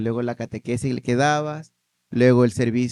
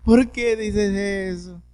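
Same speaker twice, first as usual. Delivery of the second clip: higher, slow plaintive question; hurt grandmother; 7 semitones higher, much slower than usual. Retold or imitated. Grandmother asking her grandson why he says it's her fault. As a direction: ask it wounded, like a grandmother.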